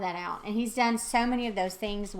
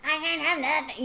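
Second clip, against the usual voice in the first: mock self-pity; 7 semitones higher, 4 dB louder than usual. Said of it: "all that silly stuff".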